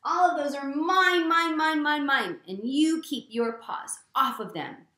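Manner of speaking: loud, high, hammed-up storybook yell; yelled temper tantrum. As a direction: shout like a greedy pug guarding toys. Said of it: "he screams and he yells and he says".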